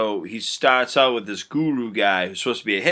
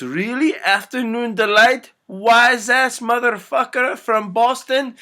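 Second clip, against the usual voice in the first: high, loud incredulous rant; outraged tirade; 12 semitones higher, 5 dB louder, slower than usual. Acted. Hypnotist flipping out on Will, outraged. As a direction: spit it out loud and high, slowing on the insult.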